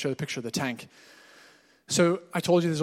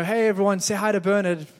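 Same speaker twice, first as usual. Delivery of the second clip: upbeat introduction; 6 semitones higher, 5 dB louder than usual. Retold or imitated. Pastor introduces Bernard to family.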